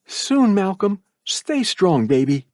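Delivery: a hint of a tender mother; mother's comfort. A narrator's reading. Mother comforts her son as she leaves. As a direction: soothe him gently.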